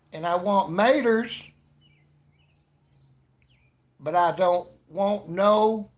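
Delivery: loud, high hammed-up Southern drawl. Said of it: "but their Southern accent. They're like".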